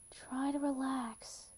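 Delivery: soft and very slow, right at his ear; close in his ear. A narrator's reading. Kurt calming nervous Blaine at the counter, soft and slow. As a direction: ease his nerves softly, slowly. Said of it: "Kurt said in his ear".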